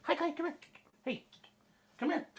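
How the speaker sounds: high, soft coo to the dog; tender pet greeting. Real, not acted.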